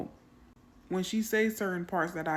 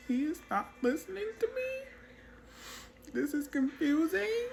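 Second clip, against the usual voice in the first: much higher, slow mock bewildered squeak; exaggerated confusion; 11 semitones higher than usual. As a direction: ham up the puzzled believer. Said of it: "I'm like".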